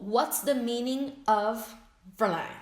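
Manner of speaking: What's the meaning of drawn out; teacher model, clearly paced; slow model question. Shown as a demonstration.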